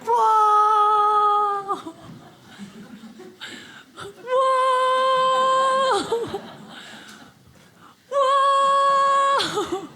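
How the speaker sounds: long, high, held whoa; hammed-up high astonishment; astonished. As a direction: draw it out in exaggerated amazement. Exaggerated reaction to friend's price.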